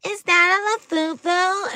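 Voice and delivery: high, slow mocking question; exaggerated snooty squeal; snotty mean girl. Mean girls calling out a fake Labubu, high and snotty. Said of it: "the B I T C H's go".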